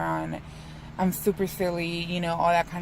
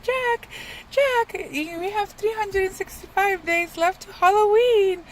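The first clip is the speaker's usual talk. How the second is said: frantic cartoon mayor, much higher and louder; Mayor of Halloween Town; 14 semitones higher, 4 dB louder, slower than usual. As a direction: squeal it out like a panicking small-town mayor. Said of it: "the mayor basically is all like".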